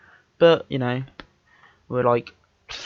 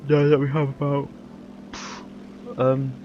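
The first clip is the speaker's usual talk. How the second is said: yawning; 2 semitones higher than usual. Real, not acted. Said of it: "sorry for the yawn".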